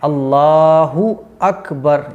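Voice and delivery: अल्लाहु अकबर very slow, with the u of अल्लाहु clear; corrected अल्लाहु अकबर, slow and careful; slow, clear pronunciation. Shown as a demonstration. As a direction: calmly spell out अल्लाहु अकबर, slow and clean. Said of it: "इस गलती को सही करना है".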